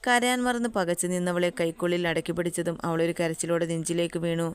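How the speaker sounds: ordinary delivery, nothing marked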